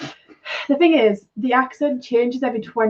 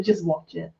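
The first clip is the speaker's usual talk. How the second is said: softer, fed-up just watch it; softly repeated plea to just watch; quiet, exasperated; 4 semitones lower, 7 dB quieter than usual. Real, not acted. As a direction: drop the voice, weary. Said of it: "You're clearly interested".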